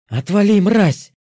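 high, loud furious bark; barking shout. Acted. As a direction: snap it out at full volume. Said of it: "гаркнула ведьма".

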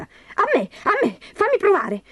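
very high, squealing demand; eager clamouring. A narrator's reading. Berta grabbing for the prince's slipper, eager squealing. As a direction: squeal to get it first. Stepsisters squabble over the slipper.

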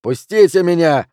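loud, high protest; enraged cry to be released; yelling. A narrator's reading. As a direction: yell as if being held back. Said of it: "вопил Джуниор".

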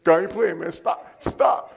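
loud, high mock protest ending in breathy cries of stop; hammed-up refusal to laugh; playful loud stop, stop. Fending off being made laugh.

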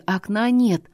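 usual speaking voice

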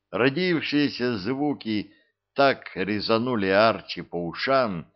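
ordinary delivery

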